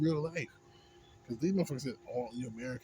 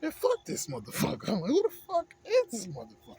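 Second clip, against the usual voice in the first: a real burst of disgust at the accuser; exasperated outburst; 14 semitones higher, 8 dB louder than usual. Real, not acted. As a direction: pitch jumps way up, louder, fed up. Ranting about people accusing Americans.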